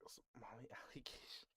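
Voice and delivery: breathy whispered aside with a giggle; laughing whisper. Real, not acted. Streamer whispering chat's joke to herself, giggling. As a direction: whisper it like a guilty secret.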